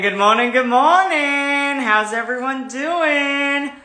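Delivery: high, slow sing-song good morning; sing-song greeting. Acted. Host greeting her viewers in a high sing-song. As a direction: stretch it out like a cheery morning host.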